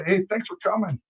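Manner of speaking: mock-cheery, high-pitched farewell to visitors; friendly fans. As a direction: play the fans suddenly all hospitality. Voices friendly fans after brutal game.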